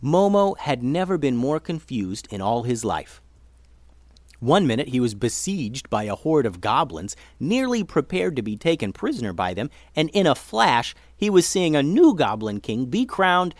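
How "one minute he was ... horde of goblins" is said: plain reading voice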